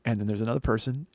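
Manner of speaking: ordinary talk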